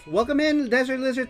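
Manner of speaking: normal talking voice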